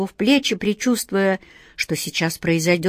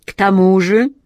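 jealous genie; 6 dB louder than usual. Acted. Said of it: "ревнивый и подозрительный взгляд".

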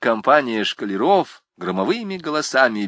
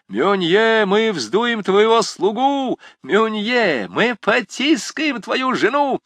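high, jeering taunts, drawn out; cheeky student taunting the librarian; 11 semitones higher, 4 dB louder, slower than usual. Acted. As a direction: tease him mercilessly from up on the pillar. Jean taunts Munier.

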